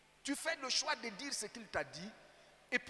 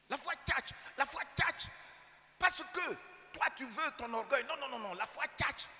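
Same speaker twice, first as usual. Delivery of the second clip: Abidjan street slang, higher and much louder; preacher shouting in Ivorian street slang to the crowd; Ivorian street talk, shouted; 5 semitones higher, 6 dB louder than usual. Acted. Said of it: "comme les gens ordinaires de la rue en Côte d'Ivoire".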